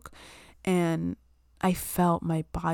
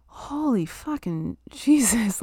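slow, higher, stunned curse with a laugh; shocked exclamation; 5 semitones higher, much slower than usual. Retold or imitated. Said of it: "And I was like".

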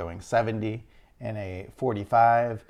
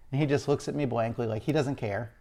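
usual speaking voice

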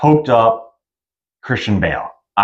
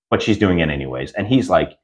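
ordinary talk

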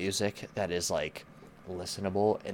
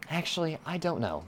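much higher, giving up; exasperated; 10 semitones higher than usual. Real, not acted. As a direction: throw up your hands, higher. Repeats the phrase much higher.

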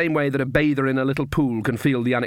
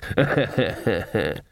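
put-on chuckle; 2 semitones lower than usual. A narrator's reading. Sharpers feign good humour with Granny, chuckling. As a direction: run of rhythmic sniggers.

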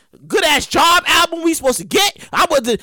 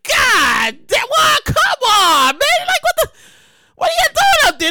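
exasperated; 11 semitones higher, slower than usual. Pretend. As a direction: yell at the slow line in mock outrage. Complaining about the long line.

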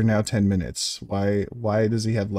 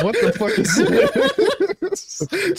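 very high, slow and drawn out; squeaky outraged; 3 dB louder, much slower than usual. Retold or imitated. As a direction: stretch it out in a squeak. Mocking character's reaction.

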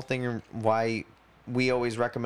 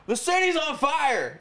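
much higher, slow, panicked doomsayer cry; ranting street crank; 3 dB louder, slower than usual. Pretend. Mocking Crazy Joe down the street, high and alarmed. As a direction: play the street-corner prophet yelling doom.

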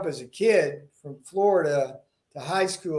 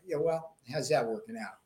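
ordinary delivery

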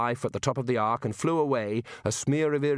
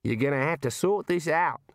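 higher-pitched, pushy kangaroo voice; talking kangaroo; 4 semitones higher than usual. Acted. Kangaroo telling Rincewind to sort it out, blunt character voice.

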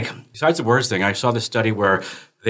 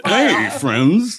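louder mock announcer greeting; radio phone voice; 5 semitones higher, 4 dB louder than usual. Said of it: "is that what I sound like".

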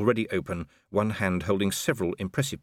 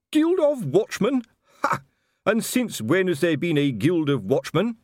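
high, loud scoffing disbelief; scornful; 10 semitones higher, 4 dB louder than usual. Acted. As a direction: sneer at the very idea. Colon scoffs at the guild.

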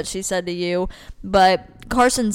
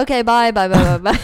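hurried goodbye; 3 dB louder than usual. Pretend. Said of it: "you said we gotta wrap it up".

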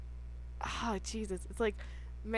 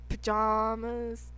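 slow, drawn-out British "Pajamas"; British accent; 4 dB quieter, much slower than usual. Shown as a demonstration. Attempting German, landing on a slow British accent. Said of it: "That was just British".

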